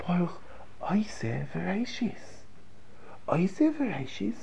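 slow, whispered, reverent; whispered prayer. Shown as a demonstration. Rabbi demonstrating to class, whispering slowly. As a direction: breathe the words out slowly. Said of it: "my intonation, my voice".

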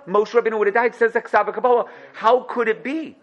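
normal talking voice

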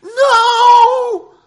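a huge, high, full-throated NO; anguished scream. Acted. A man watching himself waste his wine, screaming in anguish. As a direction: scream as you watch it all pour away. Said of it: "He's screaming at the top of his lungs".

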